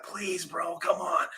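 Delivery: soft mock begging; quiet retold plea; hushed pleading. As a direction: plead under your breath, imitating a friend. Retold friends begging him quietly.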